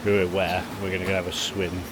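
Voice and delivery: ordinary talk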